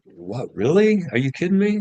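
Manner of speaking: high, loud mock disbelief; mock surprise. Retelling his reply to the Russian lady, mock astonished. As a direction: act amazed at being famous.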